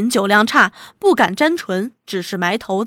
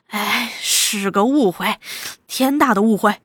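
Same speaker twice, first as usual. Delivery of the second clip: ingratiating, with a forced smile; 2 semitones lower, 3 dB quieter than usual. A narrator's reading. Captured chief explaining the misunderstanding, forced smile. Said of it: "勉强堆出笑容".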